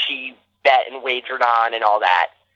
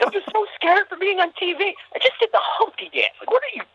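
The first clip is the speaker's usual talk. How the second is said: high, wobbly fake sob; fake crying. Kid faking tears to the TV crew, high sobbing whine. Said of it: "Made myself cry".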